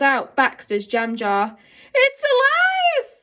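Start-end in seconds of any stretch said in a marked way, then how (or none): 1.9-3.1 high, slow, startled shriek; alarmed cry. A narrator's reading. Girl shrieking as the beetle moves, frightened. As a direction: recoil from the jar in fright.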